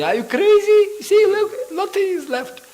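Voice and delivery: very high, loud indignant wife voice; shrieking wife. Retold or imitated. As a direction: screech it like an outraged wife. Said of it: "And the wife say".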